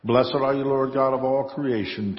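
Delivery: ordinary delivery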